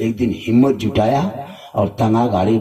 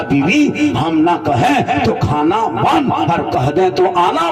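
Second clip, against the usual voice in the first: rising punchline; 8 semitones higher than usual. Acted. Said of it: "punchline delivered to audience".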